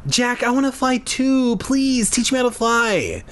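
high, pleading little-sister style whine; begging. A narrator's reading. Mia begging Jack for flying lessons, pleading. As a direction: plead in a held high pitch. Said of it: "Mia begged".

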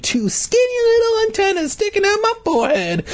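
very high, tiny voice; squeaky bee voice. Acted. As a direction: squeak it like a bug. Describing bee body.

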